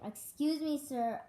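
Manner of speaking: put-on shouted Excuse me, sir at an old man. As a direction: call out over his deafness. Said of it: "I said even louder".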